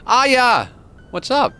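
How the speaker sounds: high, loud hello to a friend; light up as a friend arrives; excited greeting. Real, not acted. Streamer greeting his friend Aya, high and loud.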